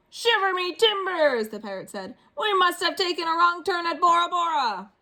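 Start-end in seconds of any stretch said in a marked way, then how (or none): 0.1-1.5 high, slow, loud pirate exclamation; storyteller's pirate voice. Acted. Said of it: "I practiced my pirate voice". 2.4-4.9 high pirate voice, louder; a lost pirate grumbling; storyteller's pirate voice. Acted. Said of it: "I practiced my pirate voice".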